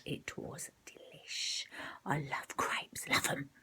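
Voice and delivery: slow savouring whisper. Real, not acted. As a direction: whisper it like a guilty treat. Relishing the crepe.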